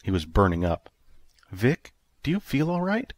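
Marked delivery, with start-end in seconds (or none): 1.5-3.1 worried. Acted. June worried, feels fever.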